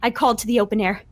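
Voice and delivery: usual speaking voice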